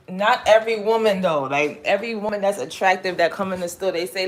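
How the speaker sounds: normal talking voice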